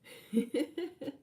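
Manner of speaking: short high laugh; a bright burst of laughter. Real, not acted. Ending her first-reading story, a real laugh.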